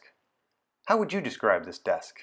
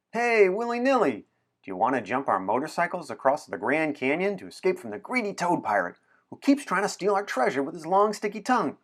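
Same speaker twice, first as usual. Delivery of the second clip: much higher and louder, bursting with excitement; very excited pitch; 6 semitones higher, 5 dB louder than usual. Acted. Anna tempting William to jump the Grand Canyon, wildly excited. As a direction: shout the adventure out with wild glee. Anna's biggest adventure pitch.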